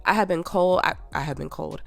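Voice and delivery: normal talking voice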